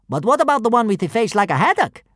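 high objecting kidnapper. A narrator's reading. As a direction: protest it loudly. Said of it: "objected the first kidnapper".

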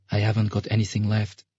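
lightly voiced girl, kept small; subdued girl. Sasha telling the ferryman she is penniless, subdued. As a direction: answer with a touch of guardedness.

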